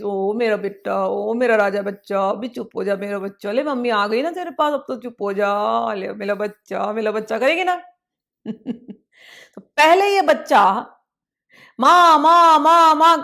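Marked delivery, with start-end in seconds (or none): 0.0-7.4 put-on calm a crying baby in your lap; mother lulling her crying child; soothing mother. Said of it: "प्यार से उसको सहलाएगी". 11.8-13.2 put-on loud child calling mummy; child calling his mother. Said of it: "कर रहा है".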